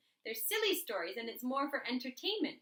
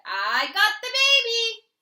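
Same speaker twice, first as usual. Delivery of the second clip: triumphant announcement; 9 semitones higher, 13 dB louder, slower than usual. A narrator's reading. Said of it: "she said".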